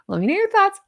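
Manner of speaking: "thoughts" lifted high and bright; sing-song sign-off. Real, not acted. Teasing viewers with a bright high sign-off. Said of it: "I keep saying thoughts".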